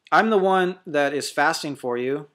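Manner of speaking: normal talking voice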